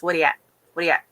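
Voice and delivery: quick 'What are you at' slurred into 'whaddya at', Newfoundland style; Newfoundland dialect. Shown as a demonstration. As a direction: toss off the Newfoundland greeting as one word.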